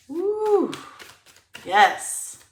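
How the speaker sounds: loud, high whoop, then emphatic yes; excited. Real, not acted. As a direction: burst out with a celebratory whoop. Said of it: "Am I excited enough?".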